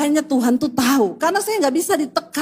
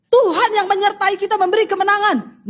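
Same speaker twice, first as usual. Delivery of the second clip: high, proclaiming retort; bold declaration; 6 semitones higher than usual. Retold or imitated. Yosua and Kaleb answer the spies, boldly declaring.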